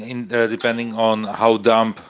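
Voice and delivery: ordinary delivery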